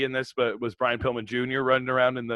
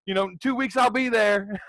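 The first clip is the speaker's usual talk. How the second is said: high, goofy promo voice, pitched way up; mock-serious excuse, much higher than his own voice; wrestler cutting a lost promo; 10 semitones higher, 3 dB louder than usual. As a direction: ham up a clueless lost wrestler. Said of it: "the other guy would be like".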